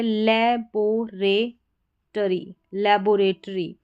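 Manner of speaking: लेबोरेटरी drawn out slowly with breaks, "le-bo-re-tree"; syllable by syllable. Shown as a demonstration. Teacher reading a word to learners, लेबोरेटरी slowly split into syllables. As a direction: spell out the sounds of लेबोरेटरी one at a time.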